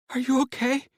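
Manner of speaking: high, tearful woman's voice; overjoyed wife's high voice. Acted. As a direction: cry out in joy as the wife.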